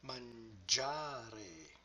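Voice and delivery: "mangiare" softly drawn out, stressed man-GIA-re; soft, careful model of "mangiare", long middle syllable; stress on second-last syllable. Shown as a demonstration. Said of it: "placing the stress on the correct syllable".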